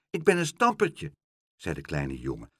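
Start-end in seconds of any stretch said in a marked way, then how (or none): 0.1-1.1 put-on high small child's voice; a lost little boy asking timidly. A narrator's reading. Lost boy asks Pluk the way, small high child voice.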